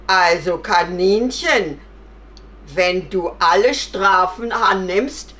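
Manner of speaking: loud, deliberate bear verdict; bear passing sentence. Acted. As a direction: pronounce judgement in a big voice. (lauter, bedächtiger Bär)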